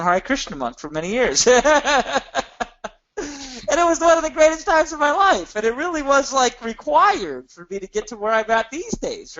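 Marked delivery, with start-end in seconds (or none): 1.5-2.9 a high, delighted laugh; warm laugh. Real, not acted. Laughs about being a monk. 3.7-9.4 said high and laughing. Real, not acted. Laughing recalling monk years.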